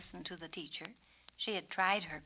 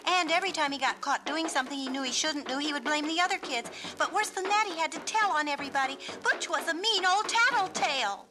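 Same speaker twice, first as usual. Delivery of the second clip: louder, higher, rushing list of complaints; wound up, faster and faster; 8 semitones higher, 8 dB louder, faster than usual. Acted. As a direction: rattle it off faster and faster. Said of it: "She talked faster and faster".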